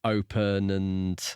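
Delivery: normal talking voice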